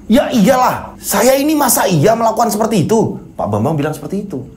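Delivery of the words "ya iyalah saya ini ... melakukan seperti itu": indignant retort. Acted. Bambang indignant at acquittal.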